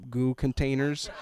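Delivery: usual speaking voice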